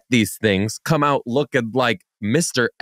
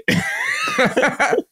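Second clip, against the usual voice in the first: very high squeal of laughter; high-pitched laugh. Real, not acted. Cracks up at own joke.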